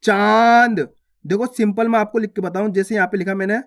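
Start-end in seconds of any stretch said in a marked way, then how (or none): 0.0-0.8 चांद with a long, nasalised vowel. Shown as a demonstration. Teacher showing learners chandrabindu, long nasal चांद. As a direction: draw out चांद loudly through nose and mouth. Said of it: "नाक और मुंह दोनों से".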